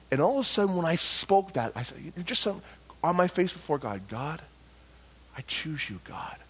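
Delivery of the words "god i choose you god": very soft, low prayer; quiet, yielded prayer; hushed surrender. Retold or imitated. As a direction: murmur it humbly, face to the floor. Prostrate, humble surrender in story.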